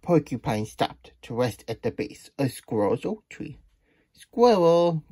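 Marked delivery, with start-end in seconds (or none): 4.1-5.0 loud, high call to a neighbour. A narrator's reading. Porcupine calling up to Squirrel, calling out. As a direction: call up the tree, raised pitch. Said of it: "Porcupine called".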